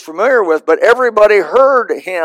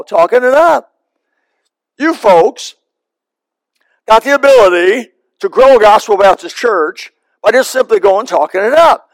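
ordinary talk, nothing marked

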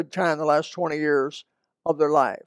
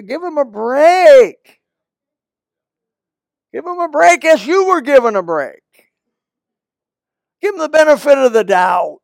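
preaching at full force; 7 semitones higher, 7 dB louder than usual. Real, not acted. Preacher urging the church to forgive, loud and high. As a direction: bring it home to the congregation.

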